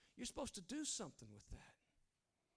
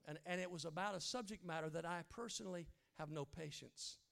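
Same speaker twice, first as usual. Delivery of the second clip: ordinary talk, nothing marked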